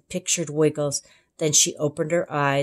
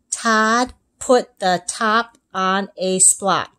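put-on slow, careful child reading; slow misreading; slower than usual. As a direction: read it slowly with the slip. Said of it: "he read".

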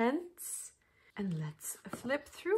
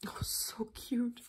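slow breathy whisper; 2 semitones higher, 7 dB quieter, slower than usual. Real, not acted. Fan whispering over booklet photos, slow whisper. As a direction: whisper it, charmed.

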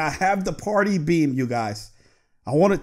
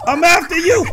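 excited taunt; 10 semitones higher, 6 dB louder than usual. Real, not acted. Taunting the ghost while hunting.

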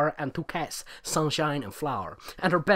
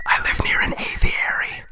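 whisper; 5 dB louder than usual. Pretend. Running joke whispered.

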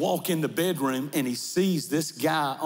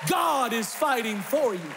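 preaching at full force; 12 semitones higher than usual. Acted. Pastor lifting the congregation, full-force preaching. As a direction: build it like a revival preacher.

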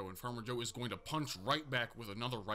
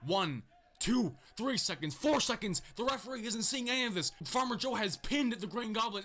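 frantic pin count; 8 semitones higher than usual. Real, not acted. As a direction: count the pin as the excitement builds. Counting a long pin, rising.

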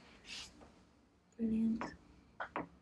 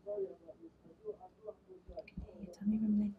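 whispering; 11 dB quieter than usual. Real, not acted. Quiet talk during palpation.

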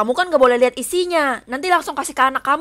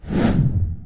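shocked unvoiced gasp. Opens the bag.

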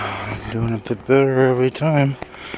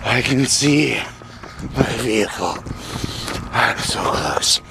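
whispering. Real, not acted. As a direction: whisper it to yourself. Nearly back at the car.

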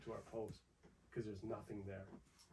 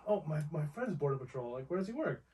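raised, eager imitation of a braggart; mock poser; 5 semitones higher, 5 dB louder than usual. Teasing fake Border Patrol claimers, higher and eager. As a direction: ape someone bragging about a friend. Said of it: "I hear so many people who are like".

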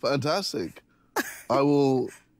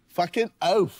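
higher-pitched attempt at bogan Australian; bogan Australian accent; 6 semitones higher, slower than usual. Shown as a demonstration. Contestant repeating his date's phrase, put-on bogan Australian accent. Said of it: "You gotta be a bit more, like, bogan".

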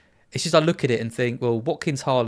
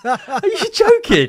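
laughing; 9 dB louder, much slower than usual. Real, not acted. Connection drops mid podcast again.

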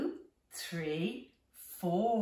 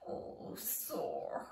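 soft unvoiced whisper of pain; hushed sympathetic whisper; whispered ouch; 7 dB quieter than usual. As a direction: breathe it out like a sore tummy. Caterpillar's tummy ache.

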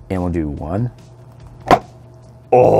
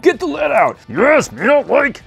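mock cartoon voice, much higher; squeaky put-on voice teasing the toy; silly high voice; 14 semitones higher, slower than usual. Vlogger voicing a silly figure teasing Hulk, high cartoon voice. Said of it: "It's like".

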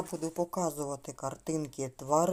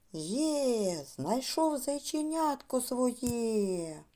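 higher, drawn-out cheer of a happy bunny; joyful cry; 5 semitones higher, much slower than usual. Acted. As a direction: cry out in delight, stretching the words. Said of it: "Він так зрадів, що закричав". (радісний протяжний вигук зайчика)

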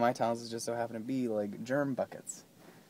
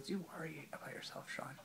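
whisper; 5 semitones higher, 14 dB quieter, faster than usual. Retold or imitated. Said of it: "he goes".

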